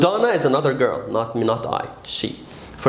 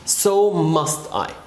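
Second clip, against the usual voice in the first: slow, careful model line; teacher models the reply slowly; slow model answer; much slower than usual. Shown as a demonstration. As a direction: say it slowly for students. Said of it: "I say".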